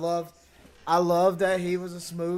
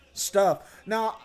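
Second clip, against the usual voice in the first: normal talking voice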